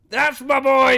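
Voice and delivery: high, loud proud cheer; dad proud of his son passing the test. Retold or imitated. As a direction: cheer like a thrilled father. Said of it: "He was so excited".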